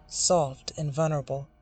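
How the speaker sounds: ordinary delivery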